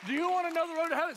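high, loud, very fast retold invitation; excited rapid question. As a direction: race through it at a high pitch. Excited rapid repeat, next person.

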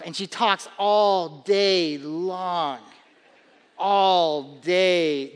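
"all" stretched long and very slow, leaning on it; drawn-out all. Shown as a demonstration. Father tells the church his daughter talks all day, drawn-out all. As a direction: draw out "all" as if the day never ends. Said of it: "Do you hear that?".

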